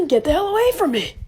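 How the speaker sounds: shouting at the screen; loud yelling. Real, not acted. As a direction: yell it, fed up. Yelling at anime character.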